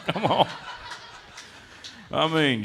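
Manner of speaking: ordinary delivery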